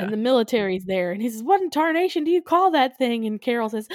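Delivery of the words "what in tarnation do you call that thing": loud, high-pitched mock outburst; mock military alarm. Military man confronting a giant ant, mock alarmed shout. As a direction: play an incredulous movie soldier.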